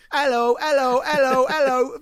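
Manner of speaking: high, loud fake Cockney hello, hello; mock an American's terrible Cockney accent; bad Cockney accent. Critic mocking the singer's fake Cockney in a loud, high voice. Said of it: "an American person doing a shite Cockney accent".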